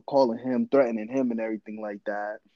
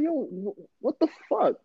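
high-pitched stammer of disbelief; retold shock; 13 semitones higher than usual. Man reacts to his ex's wild request, retold high-pitched shock. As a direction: act out being stunned, voice jumping up high.

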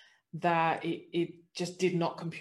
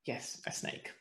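hushed aside; 9 dB quieter than usual. Real, not acted. Stressing the surprise of a snake.

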